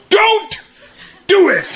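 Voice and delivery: put-on very high boyish command; defiant kid. Boy stops dad before a beating, high-pitched and defiant. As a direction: halt dad with two high, separated bursts. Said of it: "I stopped my dad".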